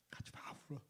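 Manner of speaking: near-silent tearful whisper; choked whisper. Real, not acted. Pastor weeping as he tells the congregation, whispered. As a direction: barely get the words out through tears.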